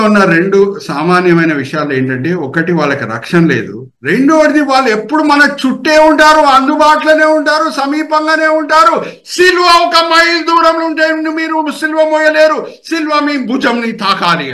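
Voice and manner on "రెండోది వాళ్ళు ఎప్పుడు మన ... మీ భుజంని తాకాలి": high-pitched, sustained preaching cry; impassioned preaching. Acted. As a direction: ring each phrase out high to the congregation. Preaches at full force.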